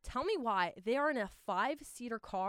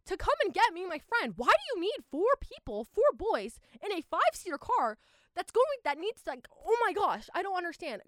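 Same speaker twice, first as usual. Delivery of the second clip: higher, louder, all worked up about the crowded car; exasperated rant; 8 semitones higher, 6 dB louder than usual. Real, not acted. Telling viewers about the friends who picked her up, loud, high exasperated rant. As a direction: let the disbelief burst out loud and high.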